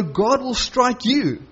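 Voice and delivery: measured rebuke. Retold or imitated. Paul answering the high priest, slow measured rebuke. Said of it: "the intonation".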